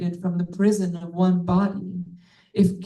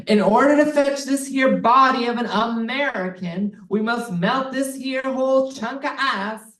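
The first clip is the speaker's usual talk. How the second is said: a lightly put-on Texan accent, a bit higher; bad Texan accent; 4 semitones higher than usual. Narrator mocking global warming agents in a bad Texan accent. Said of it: "Not a very good Texan accent".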